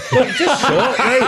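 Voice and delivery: high, loud words through real laughter; can't get it out for laughing. Real, not acted. Cracking up while telling the friends the punchline.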